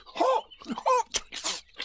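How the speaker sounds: very high, spluttering creature voice; mumbling. A narrator's reading. Tiger with paw in his mouth, mumbling high. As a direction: splutter through a sore, prickled mouth. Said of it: "mumbled Tigger".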